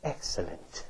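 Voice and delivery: breathy whispered villain gloat; sinister devil whisper. Retold or imitated. The devil gloats behind the grumbling crowd, whispering. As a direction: hiss it like a scheming devil. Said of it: "he's back there".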